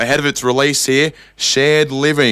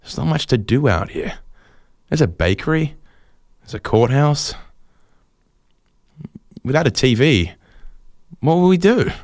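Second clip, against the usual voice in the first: mock solemn. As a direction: deliver it like a sombre eulogy for the town. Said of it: "he says very solemnly".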